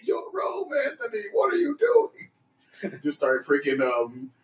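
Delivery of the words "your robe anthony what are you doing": high-pitched pretend exasperation; mock scolding. Friend play-ordering Anthony to don his robe, high mock scolding. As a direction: scold him in a squeaky mock panic.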